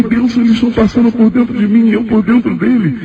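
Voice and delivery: retold amazement, pitched high throughout; astonished exclamation. Man tells God they pass through him, high astonishment. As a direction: marvel aloud to God in a high voice.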